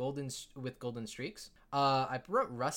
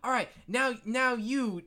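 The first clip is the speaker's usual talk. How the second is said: put-on high-pitched kid running the game; kid game master; 8 semitones higher, slower than usual. Kid game master calling on the next player, high voice. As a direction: pitch it up, searching for the name. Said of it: "He's like".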